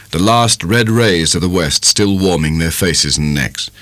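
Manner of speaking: plain reading voice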